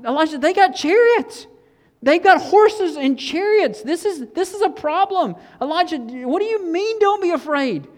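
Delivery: high-pitched, incredulous acted protest; panicked disbelief. Servant argues with Elisha about the surrounding army, in panicked disbelief. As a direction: play the frantic servant, pitch way up, stretching the alarming words.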